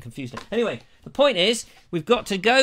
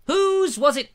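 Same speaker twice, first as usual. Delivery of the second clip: high, louder teasing repeat; squeaky mock-mysterious whose; mock suspense; 11 semitones higher, 4 dB louder, slower than usual. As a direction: tease it up high like a riddle.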